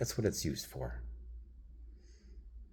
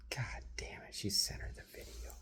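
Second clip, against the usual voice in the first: a muttered whisper under the breath; whispered aside; 5 semitones higher, 9 dB quieter than usual. Real, not acted. Venting to the camera about family, whispered. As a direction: drop to a secret whisper.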